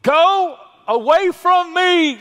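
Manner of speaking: a loud, high, slow cry of Peter pushing the Lord away; Peter's cry. Acted. Preacher acting Peter at Jesus' knees, loud high drawn-out cry. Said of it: "and he said, quote".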